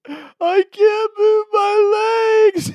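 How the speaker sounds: very high, loud, slow wailing cry; boy crawling and crying he can't move; crying child. Retold or imitated. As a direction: wail each word like a sobbing kid. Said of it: "he's crying".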